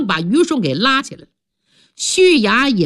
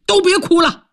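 higher-pitched sudden yell to cut the weeping; sudden shout; 10 semitones higher than usual. Acted. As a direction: snap everyone out of crying with one cry. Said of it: "突然有人大喊一声". (突然大喊一声，音调拔高)

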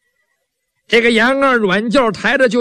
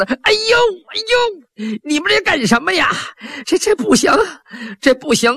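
much higher, yelping hurt protest; shrill cry of pain; 8 semitones higher than usual. Acted. Wounded Yan Er yelps at servants pulling his trousers, high pained cry. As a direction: yelp as the cloth tears at the wound. Trousers stuck to bleeding legs.

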